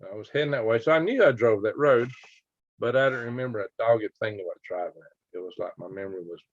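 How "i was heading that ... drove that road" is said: normal talking voice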